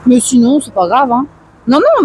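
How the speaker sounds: usual speaking voice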